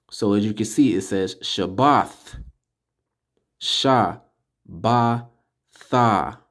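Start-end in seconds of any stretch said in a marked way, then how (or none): 3.6-6.4 Sha, Ba, Tha each said alone with pauses between; syllable by syllable. Shown as a demonstration. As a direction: break Shabbath into Sha, Ba, Tha. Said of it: "you're putting Ba and Tha together".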